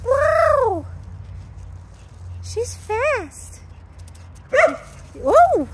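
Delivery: high, loud wow and whoa; amazed exclamations. Real, not acted. Trainer watching the puppy sprint, loud and amazed. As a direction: gasp at how fast she runs.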